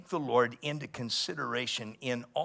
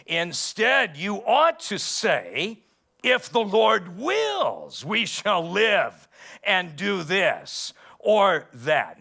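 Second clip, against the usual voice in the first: slow, loud, higher preaching voice; scripture reading; 7 semitones higher, 9 dB louder, much slower than usual. Acted. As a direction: proclaim each word slowly from the pulpit. Preacher reads scripture aloud.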